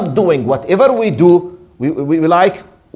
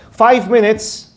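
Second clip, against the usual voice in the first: ordinary delivery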